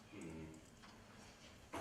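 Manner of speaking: very soft, low hum of scheming; a quiet scheming hum; thoughtful hum. Acted. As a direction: hum as the plot forms. Aaron considers killing the witnesses.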